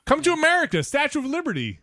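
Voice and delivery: mock announcer. Voicing a propaganda poster.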